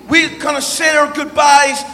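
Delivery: usual speaking voice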